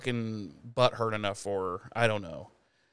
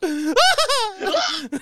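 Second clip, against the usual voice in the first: high-pitched comic shrieks; mock startled scream; 6 dB louder than usual. Imitating Giles surprised in the shower, high mock screams. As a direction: scream in pretend shock, twice.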